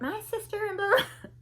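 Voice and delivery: high-pitched mock prattle; chatterbox imitation. Woman chattering about her sister, high mock prattle. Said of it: "She was like".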